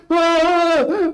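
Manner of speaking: sudden high piercing "Ah!"; Gus scream. Retold or imitated. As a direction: let out one short shriek. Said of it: "like, the Gus scream".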